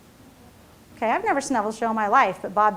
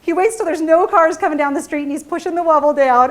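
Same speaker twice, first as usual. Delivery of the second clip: ordinary talk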